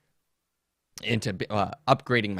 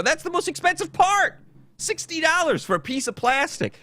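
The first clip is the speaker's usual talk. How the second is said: much higher, exasperated retold protest; high indignant complaint at the salesman; indignant outburst. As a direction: sell your disbelief at the price. Retelling outrage at price.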